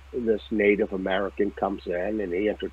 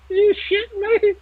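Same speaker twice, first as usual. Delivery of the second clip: a much higher, louder squeal of disbelief; stunned disbelief; 5 dB louder than usual. Retold or imitated. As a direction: shriek it like you can't believe your ears. Disbelief at wife's stunt.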